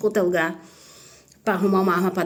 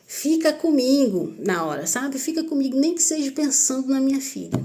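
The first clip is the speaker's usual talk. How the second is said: mãe imitada; 6 semitones higher than usual. Retold or imitated. Mother talking to her husband about her daughter, imitated higher plea. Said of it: "ela falava pra ele".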